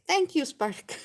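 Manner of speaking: amused thanks to a cat. Retold or imitated. I was like.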